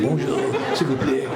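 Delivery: light mock French accent, laughing; imitating French accent. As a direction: put on a playful French accent. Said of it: "They spoke with such a accent so nice".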